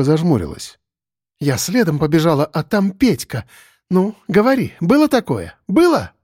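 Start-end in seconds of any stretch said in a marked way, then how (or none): 1.4-6.1 demanding. Acted. Lyusya demands that Sandro admit it, rising shout. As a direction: press him hard for an answer.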